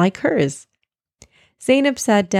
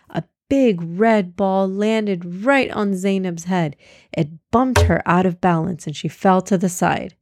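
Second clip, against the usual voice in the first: plain reading voice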